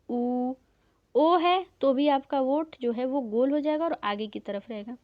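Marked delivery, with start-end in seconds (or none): none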